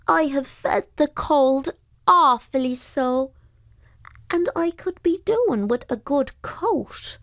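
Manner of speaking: high, slow creature voice; shivery, grateful donkey spirit; pooka voice. Acted. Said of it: "said the pooker".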